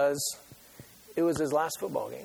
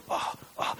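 imitating pained groans; 6 dB quieter than usual. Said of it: "he's going".

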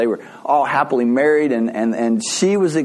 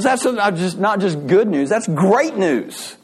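high, excited, stretching out the last words; overjoyed, pitched up; acted delight; 5 semitones higher than usual. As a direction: build to a drawn-out, thrilled "great news". Friend celebrates the good news.

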